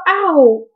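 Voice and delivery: high, loud "ow"; pained yelp. Real, not acted. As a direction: yelp from sudden real pain. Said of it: "That hurt".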